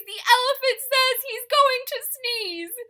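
very high, loud bird voice; high bird alarm. Acted. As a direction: shriek the news to the bees. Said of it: "same line plainer later".

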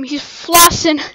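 usual speaking voice

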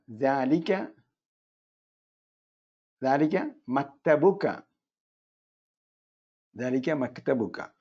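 ദാലിക stressed, said slowly twice; deliberate classroom dictation; slow model phrase. Shown as a demonstration. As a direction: spell it out slowly for learners. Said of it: "indicative pronoun".

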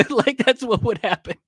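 high-pitched laughing delivery. Real, not acted. Host amused by his imagined observers' verdict, laughing. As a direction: laugh your way through the words.

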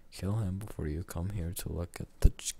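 ordinary talk

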